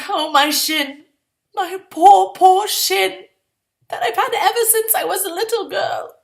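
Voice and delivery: high, hammed-up self-pity; mock lament. Girl mourning her kicked shin, hammed-up self-pity. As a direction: whimper over the poor shin.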